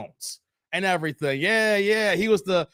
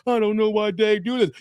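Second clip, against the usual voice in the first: mocking hater voice.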